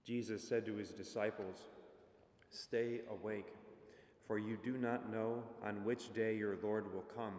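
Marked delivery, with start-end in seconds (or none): none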